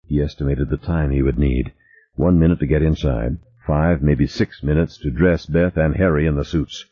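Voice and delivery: ordinary delivery, nothing marked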